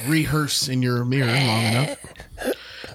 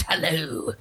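vampire voice; 2 semitones higher, 3 dB quieter than usual. Shown as a demonstration. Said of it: "Think of a vampire".

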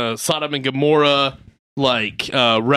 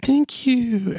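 mock girlish thanks; 10 semitones higher than usual. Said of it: "she's like".

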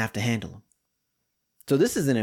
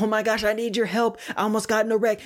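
higher-pitched mock distress call; mildly panicked wife; 7 semitones higher, faster than usual. Wife calls husband after nearly wrecking, mild imitated panic. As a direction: lightly imitate a flustered plea for help.

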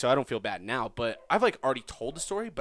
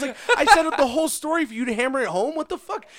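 exasperated rant; 13 semitones higher, 8 dB louder, faster than usual. Real, not acted. Ranting at friends who stole joke.

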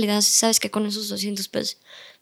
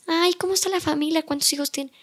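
friendly small talk; 7 semitones higher than usual. Retold or imitated. Chatting up the customer kindly.

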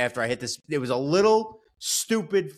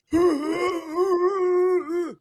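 high laugh; 5 dB louder than usual. Real, not acted. Laughing at his own crash.

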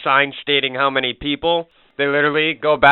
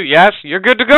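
mock cheerful clerk; 6 semitones higher, 7 dB louder than usual. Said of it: "Just like".

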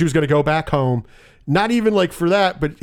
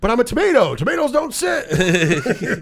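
high-pitched, hammed-up indignation; mock outrage, pitched up; indignant protest; 7 semitones higher than usual. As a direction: argue back like an affronted actor. Acted actor protesting to his agent.

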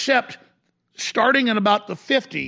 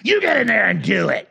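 sinister handler; 2 semitones lower than usual. Retold or imitated. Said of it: "it's like".

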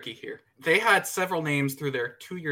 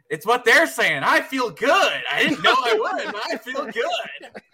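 loud high ecstatic shouting, laughing; excited shout; 9 semitones higher, 8 dB louder, slower than usual. Retold or imitated. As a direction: belt it out, giddy and high. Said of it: "he points behind him and goes".

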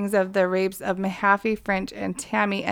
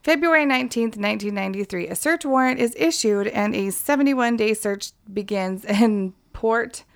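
usual speaking voice

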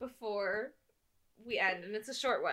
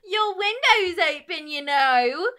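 loud, higher attempt at a British lad; a hammed-up British accent that goes wrong; bad accent; 6 semitones higher, 8 dB louder than usual. [name] teasing the girl below, loud bad accent. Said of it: "I don't know what that accent was".

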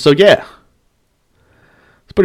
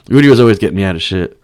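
ordinary talk

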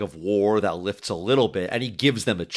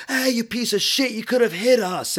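high-pitched yell, much higher and louder; mock screaming guard; 13 semitones higher, 3 dB louder than usual. Man nearly shot yells at the other guard, high angry yell. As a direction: shriek it at the careless guard. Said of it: "screaming at the other guard".

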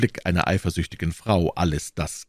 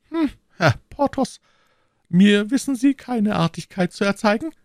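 very high falsetto wife, holding anger in; indignant high-voiced lady keeping her temper; restrained fury; 3 dB louder than usual. A narrator's reading. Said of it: "mit verhaltener Wut". (mit verhaltener Wut, in hoher Frauenstimme)

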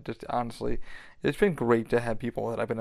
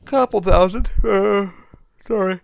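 talking through a real yawn, higher and louder; 8 semitones higher, 9 dB louder, slower than usual. Real, not acted. Podcaster apologising mid-episode, yawning. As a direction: let a yawn swell through the words. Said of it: "I wasn't yawning before I started this".

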